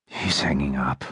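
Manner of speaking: 疲れ切った低い声で静かに; 力の抜けた、かなり低く柔らかい声; worn out low voice. A narrator's reading. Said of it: "His voice sounded worn out and exhausted".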